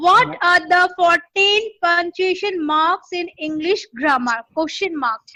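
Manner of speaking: each word drawn out for writing; slow dictation of a question. Shown as a demonstration. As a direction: read the question very slowly. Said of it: "আমি আস্তে আস্তে dictate করছি".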